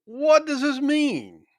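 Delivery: high, bewildered question; amazed. A narrator's reading. Crowd asking one another, high and baffled. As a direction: ask it wide-eyed, baffled and pitched well up. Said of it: "were all amazed and were in doubt".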